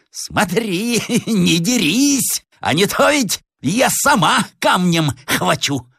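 very high, slow, hoarse threat; threatening madwoman. Acted. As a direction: warn him with a crazed cackle. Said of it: "сказала охриплым голосом". (полоумная грозит охриплым голосом)

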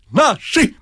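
very high exclamation; gleeful high cry; jubilant confirmation. A narrator's reading. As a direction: squeal it in triumph. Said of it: "подтверждала слепая кишка".

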